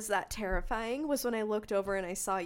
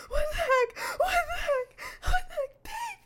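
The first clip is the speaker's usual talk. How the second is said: high panicked shriek, much louder; terrified screaming; 9 dB louder than usual. Retold or imitated. Woman wakes up screaming to her sleeping husband, high terrified scream. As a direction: scream in terror at a figure in the dark. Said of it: "And I was screaming like that".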